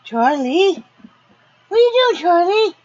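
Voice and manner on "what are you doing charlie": much higher, louder squeal at the dog; squealed question. Real, not acted.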